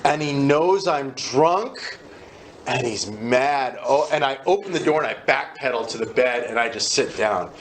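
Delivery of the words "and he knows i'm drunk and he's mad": panicked thought. Retold or imitated. Panicked inner thought retold.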